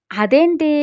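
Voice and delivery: high, loud shocked exclamation on "అదేంటి". Acted. Ritika reacting to Dev's claim, loud shock.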